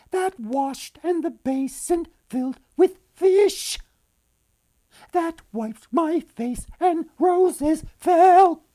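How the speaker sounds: very high, slow little bird voice. A narrator's reading. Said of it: "a little bird singing".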